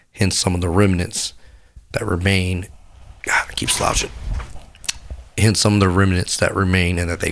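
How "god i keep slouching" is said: breathy whisper to himself, off-mic; whispered aside. Real, not acted. Aside to himself about slouching, whispered. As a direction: hush it like a note to self.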